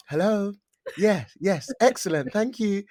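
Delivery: a hint of chirpy office politeness; work voice. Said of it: "that work voice".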